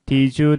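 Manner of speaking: DID YOU run together as "didju"; DID YOU as one linked word. Shown as a demonstration. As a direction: blend the d and y of DID YOU into a j sound. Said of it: "did와 you에서 더와 y가 결합하면서".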